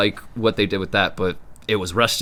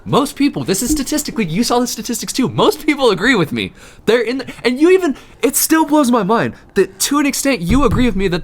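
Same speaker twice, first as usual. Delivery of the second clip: high, loud, heated rant; worked up; 8 semitones higher, 3 dB louder than usual. Real, not acted. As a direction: argue like you were triggered. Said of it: "Can you tell I got triggered?".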